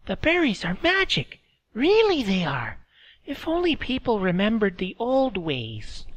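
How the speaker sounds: put-on high, thin old woman's voice; old woman speaking through a laugh; old Meredy. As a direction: voice her as a frail old village woman. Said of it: "Meredy said".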